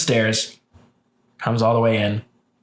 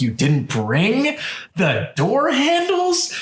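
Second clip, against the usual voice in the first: higher, slow, drawn-out disbelief; mock outrage; 12 semitones higher, slower than usual. Confronting the repairman who forgot handles, higher and slow in disbelief. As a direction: stretch each word in disbelief.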